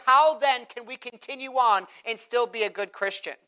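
full-force preaching. Acted. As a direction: preach it up to the congregation.

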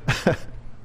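soft airy chuckle; stifled real laugh; breathy laugh. Real, not acted. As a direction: laugh quietly under your breath. Laughing at own joke.

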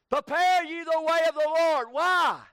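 loud, high pulpit cry; shouted scripture proclamation; forceful preaching. Real, not acted. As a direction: cry it out to the congregation. Cries out the verse.

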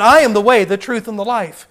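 loud, forceful Bible quote; preached scripture; preaching scripture. Acted. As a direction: proclaim it from the pulpit.

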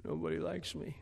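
lightly voiced sulky child, soft; a hint of a moping kid feeling sorry for himself; self-pity. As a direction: whine it softly like a pouting kid. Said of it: "self-pity".

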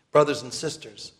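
ordinary delivery

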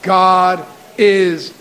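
slow unison declaration; slow call and response. Shown as a demonstration. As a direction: stretch each word for the congregation to follow. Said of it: "Can you say that with me?".

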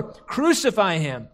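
ordinary delivery

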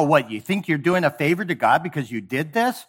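ordinary talk

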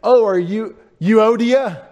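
loud, high mock greeting; hammed-up clueless hello; gushing mispronouncing greeter. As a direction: greet her with overdone, slow delight, saying "you-OH-dia". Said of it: "Don't go up and say".

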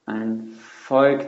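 ordinary talk, nothing marked

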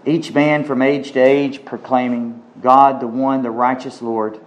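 low, reverent prayer voice. Real, not acted. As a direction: pray it humbly to God. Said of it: "Well, let's pray".

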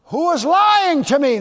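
loud, high retort; indignant challenge. Acted. Believer confronting the lie, loud and indignant. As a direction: throw the question back loudly.

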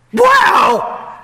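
high, loud, astonished cry; mock astonished wow; amazed shout. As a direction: shriek it like stunned disciples. Disciples amazed at resurrection.

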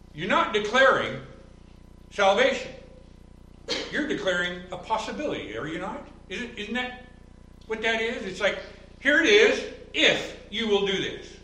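preaching at full force. Acted. Pastor preaching hard against offered salvation, high and loud. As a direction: drive the point home from the pulpit.

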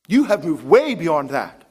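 ordinary delivery

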